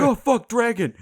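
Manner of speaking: a knight caught off guard, hammed up; mock startled cursing. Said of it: "He's, he's just like".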